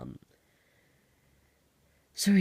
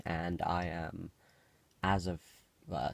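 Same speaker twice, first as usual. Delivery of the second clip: ordinary talk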